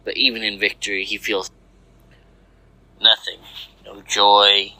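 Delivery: ordinary delivery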